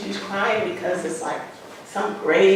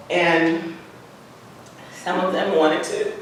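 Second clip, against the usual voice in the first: ordinary talk, nothing marked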